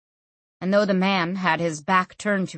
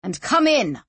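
snapped; 4 semitones higher, 3 dB louder than usual. A narrator's reading. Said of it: "snapped Ziani".

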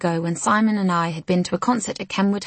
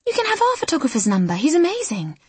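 high, fast and bright; chirpy and quick, pitched up; 9 semitones higher than usual. Acted. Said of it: "says Lizzie brightly".

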